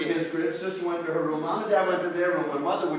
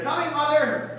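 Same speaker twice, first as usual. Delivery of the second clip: calling back; 9 semitones higher than usual. Acted. Daughter calls back to mother.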